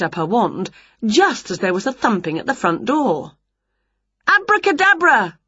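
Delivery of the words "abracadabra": high, ringing magic word; shouted spell. A narrator's reading. Winnie snatching up her wand to cast a spell, shouted. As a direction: cast it in a high-pitched call. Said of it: "shouted Winnie".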